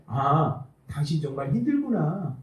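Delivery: 낮고 부드럽게 공감하는 남편 흉내; 아내를 다독이듯 조용히. Retold or imitated. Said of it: "그 힘들다는 것을 인정받고 싶은 거지". (힘든 아내를 위로하는 남편, 조용하고 다정한 말투)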